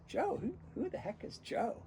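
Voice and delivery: high-pitched mock bafflement; baffled. Retelling his baffled question.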